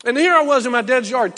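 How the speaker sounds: ordinary delivery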